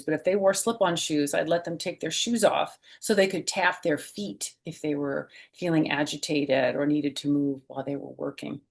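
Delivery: usual speaking voice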